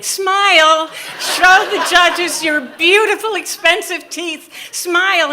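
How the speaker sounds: imitated mother shouting orders, high and loud; loud, high mock-mother command; bossy mother. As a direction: yell it like a pushy stage mother. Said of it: "I heard my mother's voice".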